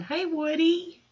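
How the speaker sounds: high, drawn-out hello to Woody; loud, high-pitched call to a returning visitor; singsong greeting. Real, not acted. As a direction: call out sweetly high and stretch it. Greets Woody, who has just returned.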